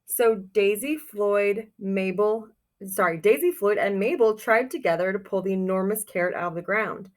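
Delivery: ordinary talk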